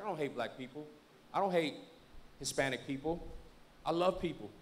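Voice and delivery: low, soft retold confession; earnest, softly voiced quote; subdued confession. Retold confession of tattooed man.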